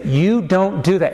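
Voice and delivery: slow, deliberate weight on each word of "You don't do that"; firm admonishment. Retold or imitated. Dad admonishing his son, "You don't do that" said slowly and firmly.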